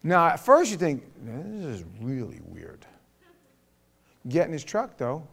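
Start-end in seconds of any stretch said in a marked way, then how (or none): 1.2-2.8 low, soft aside to himself; weirded-out, quietly acted; mock puzzlement. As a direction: voice a bemused inner thought. Acts out his first thought.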